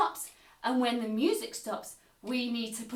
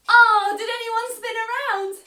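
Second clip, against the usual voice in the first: ordinary delivery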